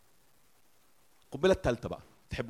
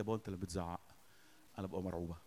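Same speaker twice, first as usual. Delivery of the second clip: soft, lower retold reply; hushed child's confession; 6 semitones lower, 11 dB quieter, much faster than usual. Daughter tells father his yelling scares her, hushed. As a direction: gently quote a daughter's quiet admission.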